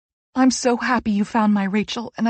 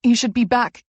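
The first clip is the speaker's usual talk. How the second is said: ordinary delivery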